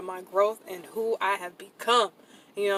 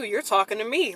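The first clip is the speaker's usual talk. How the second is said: ordinary talk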